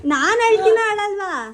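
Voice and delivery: insistent question. Real, not acted. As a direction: press the question loudly, pitch jumping up. Repeats her question over others' chatter.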